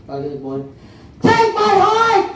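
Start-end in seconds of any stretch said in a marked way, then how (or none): 1.2-2.3 high, slow hammed-up shout; shouted offer. A subject volunteering his heart, high shout. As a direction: yell it out heroically. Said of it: "bigla may sumigaw".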